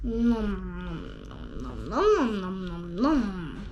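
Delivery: low munching nom noises; elephant munching. Acted. The elephant eating the giraffe, low munching noms. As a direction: chomp it like a hungry elephant. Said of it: "went the elephant".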